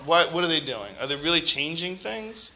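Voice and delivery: ordinary talk, nothing marked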